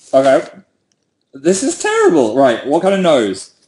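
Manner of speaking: talking round a pen held in teeth; words muffled by a pen between the teeth; pen in mouth. Real, not acted. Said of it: "I can't do the mouth thing anymore".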